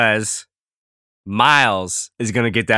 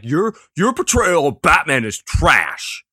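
mock online hater; 4 semitones higher, slower than usual. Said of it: "be like".

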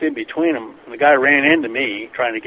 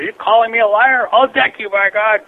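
retold screaming threat; 8 semitones higher than usual. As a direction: replay the angry guy shouting his threat. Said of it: "The guy starts screaming".

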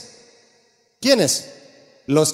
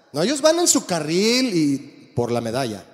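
normal talking voice

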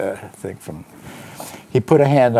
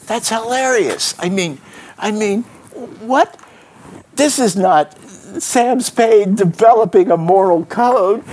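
much higher, incredulous and delighted; can't believe what he just read; amused disbelief; 13 semitones higher, 3 dB louder than usual. Real, not acted. Reader reacting to Spade's speech, amused and incredulous.

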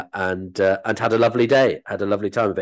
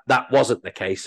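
ordinary delivery

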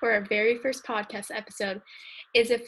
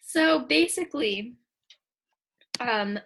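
ordinary talk

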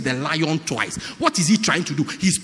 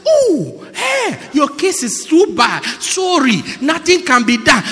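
higher, shouted mock pity; mock sympathy; 8 semitones higher than usual. Preacher mimics sympathetic listener, mock pity. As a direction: ham up fake sympathy.

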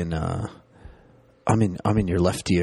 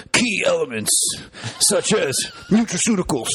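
high shouted Alex Jones rant; Alex Jones impression; 8 semitones higher than usual. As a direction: yell it like a conspiracy radio host. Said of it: "amazing Alex Jones imitation".

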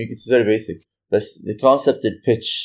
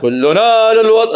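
loud, high patriotic anthem chant; anthem style; 12 semitones higher, 7 dB louder, slower than usual. Retold or imitated. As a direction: belt it like a national anthem. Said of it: "كل شيء كنت بغنيه كنت بحسه نشيد". (نشيد وطني حماسي)

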